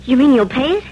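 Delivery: eager, rising disbelief; can't believe his luck; excited hope. Acted. Hopeful surprise at offer.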